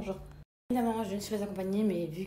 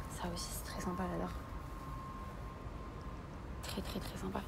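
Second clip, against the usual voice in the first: murmure à voix basse, sans timbre; chuchotement; 13 dB quieter than usual. Real, not acted. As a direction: chuchote comme dans une boutique. Chuchote dans le magasin. (chuchoté tout bas)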